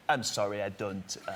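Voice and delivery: put-on low, hesitant, vaguely French accent; fake European accent. Student dodging charity collectors, fake European accent. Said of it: "I would pretend to be foreign".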